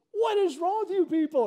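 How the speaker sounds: loud, high, furious cry; exasperated yell. Retold or imitated. Lifeguard yelling at the teens who stood by. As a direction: blast the crowd in disbelief. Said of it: "the only thought I had was".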